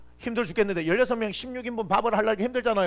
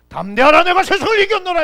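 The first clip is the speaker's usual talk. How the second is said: much louder, higher proclamation; proclaiming quote; 11 semitones higher, 13 dB louder than usual. Acted. Pastor booming Jesus' words to the congregation, proclaiming. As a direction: ring out the scripture boldly.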